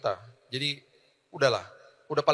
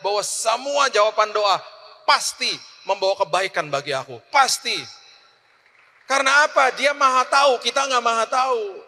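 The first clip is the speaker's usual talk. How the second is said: high, loud preacher's exhortation; preaching at full force; 8 semitones higher, 6 dB louder than usual. Acted. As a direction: drive the point home to the congregation. (khotbah berapi-api, suara tinggi dan keras)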